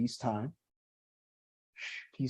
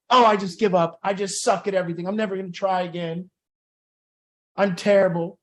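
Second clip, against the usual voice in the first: mock despair, pitched up and louder; self-deprecating whine; 4 semitones higher, 5 dB louder than usual. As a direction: ham up giving up on yourself. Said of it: "that self-deprecating thing".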